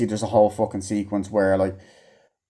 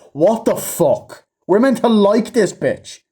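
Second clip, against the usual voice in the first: higher, slow, incredulous rant; outraged disbelief; 9 semitones higher, slower than usual. Real, not acted. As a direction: drag it out in outrage. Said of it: "ranting reaction".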